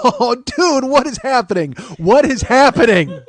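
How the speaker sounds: high, loud wail of disbelief; mock laugh-crying. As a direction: wail it loud, as if helplessly overwhelmed. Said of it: "laughing and crying at the same time".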